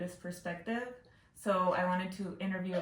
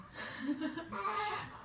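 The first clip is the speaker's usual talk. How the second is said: a short, high burst of laughter; light amused laugh; 11 semitones higher, 5 dB quieter than usual. Real, not acted. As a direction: laugh along at the punchline. Interviewer laughs at story.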